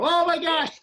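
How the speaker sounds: high, loud hammed-up exclamation; exaggerated retold outcry; mock shock. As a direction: play big shock for a laughing crowd. Playing up shock for audience.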